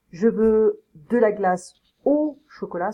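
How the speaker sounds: measured teacher's demo, pausing around "au"; deliberate demonstration with "au" held out on its own. Shown as a demonstration. Teacher models the preposition au.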